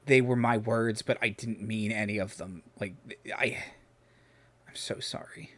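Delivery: soft, halting, fading to a whisper; stammering apology. Acted. Injured brother explaining his words to sibling, soft and stammering. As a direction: falter and trail into a breathy apology. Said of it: "he's kind of stammering".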